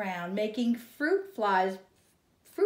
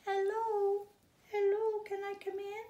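high, small birdlike voice; a tiny high chirping baby bird; little bird voice; 14 semitones higher than usual. Acted.

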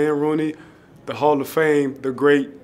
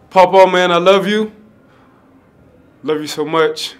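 real, louder, higher burst of love, voice cracking with feeling; emotional outburst; 5 semitones higher, 10 dB louder than usual. Real, not acted. Player to his mentor Paw Paw, loud emotional declaration of love. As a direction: let the feeling spill out loud, pitch up. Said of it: "I'm getting very emotional".

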